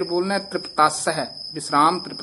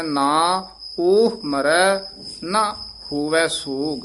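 ਨਾ drawn out long and slow; slow recitation with a long ਨਾ; long stressed ਨਾ; slower than usual. Shown as a demonstration. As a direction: stretch the vowel of ਨਾ and weight it. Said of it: "ਦੀਰਘ ਲਾ ਕੇ ਉਚਾਰਨ ਕਰਨਾ ਹੈ".

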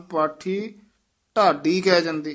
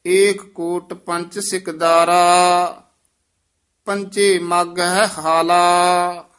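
slow, raised reading of the verse; Gurbani recitation; 7 dB louder, much slower than usual. Acted. Teacher reciting the shabad for the student. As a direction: chant the hymn out solemnly.

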